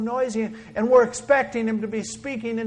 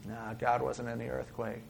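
normal talking voice

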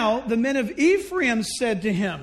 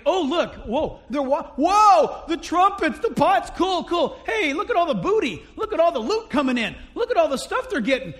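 higher and louder, wide-eyed at the loot; excited gawking; 4 semitones higher, 4 dB louder than usual. Retold or imitated. Ephraim arriving late and eyeing the loot, excited. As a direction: ooh and aah over the booty.

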